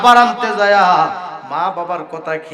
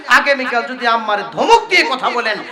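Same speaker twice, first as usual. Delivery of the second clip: ordinary talk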